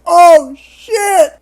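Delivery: mock startled shout, high and loud; hammed-up shocked outburst; startled yell. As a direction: yelp it like someone caught off guard. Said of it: "he gets startled".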